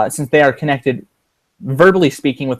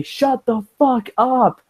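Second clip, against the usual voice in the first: high, slow, drawn-out mock yell; hammed-up shout at relatives; imagined outburst; 10 semitones higher, much slower than usual. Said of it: "wanted to just mute my mic and say".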